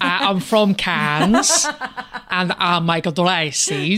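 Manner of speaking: put-on slow, drawn-out French accent; mock French accent. Co-host poses as dress designer from Cannes, slow French accent. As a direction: play a Frenchman, stretching every phrase slowly. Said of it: "I thought he was really French".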